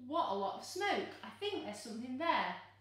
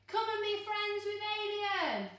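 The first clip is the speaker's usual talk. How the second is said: higher, louder calling out to a far-off friend; 9 semitones higher, 3 dB louder than usual. Shown as a demonstration. Reader showing the child how to call a monster friend, loud call. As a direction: call it out as loud as you can. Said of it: "as loudly as you can".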